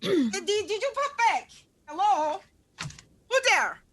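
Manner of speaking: high, loud, eager calling out; high fussy mother calling from another room; doting mother voice. Acted. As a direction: call out for your son like a fussing mother.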